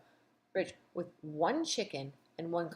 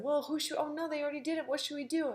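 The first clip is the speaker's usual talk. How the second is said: higher, fretful mock panic; flustered team; 9 semitones higher, faster than usual. Bernie and Ashley panicking at the U-turn, higher and fretful. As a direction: fret in a raised voice. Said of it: "and they said".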